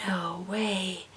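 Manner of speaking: breathless secret whisper; whispered thought. Retold or imitated. Cashier secretly reacting to the customer's yarn, whispering. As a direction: whisper it so she can't hear. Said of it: "not gonna say that out loud".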